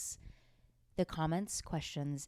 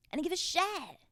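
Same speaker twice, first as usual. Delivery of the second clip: emphatic repeat; 11 semitones higher than usual. Real, not acted. Telling listeners about high school, emphatic higher repeat.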